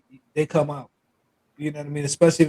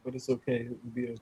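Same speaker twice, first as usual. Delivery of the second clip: fading into a sulky mumble; mock hurt; 6 semitones lower, 14 dB quieter, faster than usual. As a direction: play wounded, trailing off.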